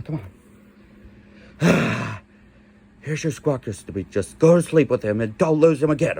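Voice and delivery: ordinary delivery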